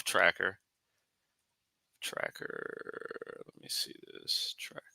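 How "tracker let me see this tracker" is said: whispering. Real, not acted. Muttering to self while searching.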